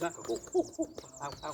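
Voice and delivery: quick playful monkey yelps; little monkey. Shown as a demonstration. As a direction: squeak like a cheeky little monkey. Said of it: "Sound like a little monkey".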